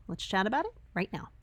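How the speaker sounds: normal talking voice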